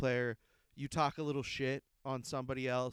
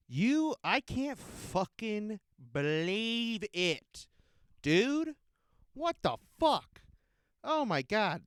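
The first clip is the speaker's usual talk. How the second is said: high, loud disbelief; outraged shout; 5 semitones higher, 4 dB louder, slower than usual. Real, not acted. Fan reacting to the mayor's proclamation, outraged shouting. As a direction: blurt out in outrage.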